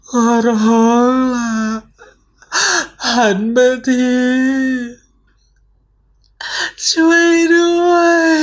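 very high, wailing ghostly voice; eerie ghost plea. Acted. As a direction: wail like the lost girl behind. Ghost voice begging behind. (เสียงผีหญิงโหยหวนขอร้อง)

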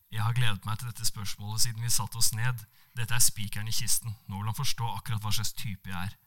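ordinary delivery, nothing marked

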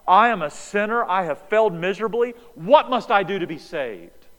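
imagined repentant cry, loud; broken sinner. Retold or imitated. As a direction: cry it out on your knees. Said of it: "should have hit the ground and said".

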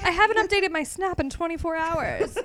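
high mock-distressed whine, half laughing; hammed-up kid upset about the phone; complaining son. Voicing the son's phone panic.

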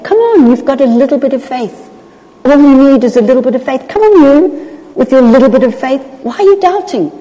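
acted cheery, fond coaxing; affectionate encouragement. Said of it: "He's not chastising at all".

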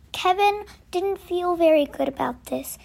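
ordinary delivery, nothing marked

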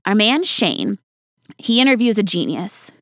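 normal talking voice

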